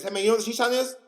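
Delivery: fast, high and loud imitation of a boy; rapid-fire kid. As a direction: rattle it off like an eager child. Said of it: "he says it that fast".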